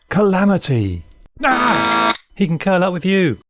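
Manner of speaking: high, lively call to the dog; calling out brightly from the kitchen; voiced Rosa. Rosa calls to the dog, pitched high.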